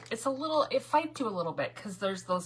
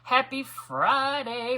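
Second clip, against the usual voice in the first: usual speaking voice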